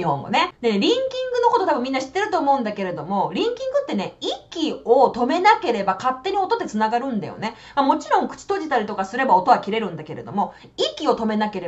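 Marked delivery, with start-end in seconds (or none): none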